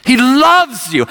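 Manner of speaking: high, slow, impassioned preacher's cry; street preaching. Retold or imitated. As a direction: stretch it out like a street evangelist. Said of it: "He's preaching like this on the streets".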